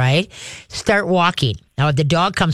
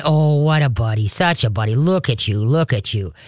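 soft dog praise; 5 semitones lower than usual. Shown as a demonstration. Trainer crouching low, praising the dog softly and warmly. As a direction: coo to the dog as he arrives. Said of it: "starts coming in".

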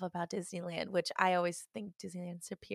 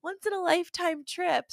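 higher, excited mock tourist voice; eager tourist; 9 semitones higher than usual. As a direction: imitate a desperate first-time visitor. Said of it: "new tourists ... that are like".

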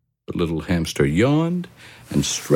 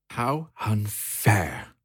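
soft, slow, sulky hamster voice; Harry the hamster; 4 semitones higher, 5 dB quieter, much slower than usual. A narrator's reading. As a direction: moan it like a bored little hamster. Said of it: "said Harry".

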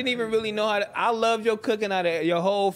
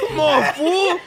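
laughing shout; 8 semitones higher, 6 dB louder, much slower than usual. Acted.

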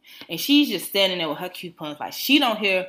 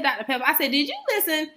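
high, raised-pitch challenge, louder; exasperated retort; 10 semitones higher, 6 dB louder than usual. Retold or imitated. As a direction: retell it as an indignant scolding. Retold drive-thru order quarrel.

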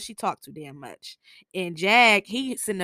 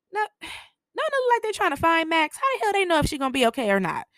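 exasperated; 9 semitones higher, 3 dB louder, faster than usual. Real, not acted. Exasperated at dumb question.

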